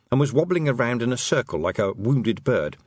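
plain reading voice

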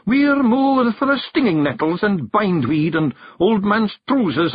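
high fairy voice, inventing desperately; desperate little fairy, pitched very high; Rob Anybody. A narrator's reading. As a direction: improvise an excuse, high and pleading. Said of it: "inventing desperately".

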